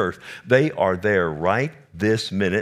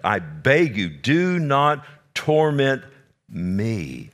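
demon pleading; 3 semitones higher, slower than usual. Retold or imitated. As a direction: stretch out the demon's desperate plea. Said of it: "the demon".

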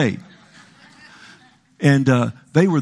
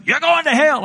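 much higher sing-song jeer; kid's taunt; 4 dB louder than usual. Retold or imitated. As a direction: jeer it like a kid leaning from a car window. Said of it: "they would hang out the window".

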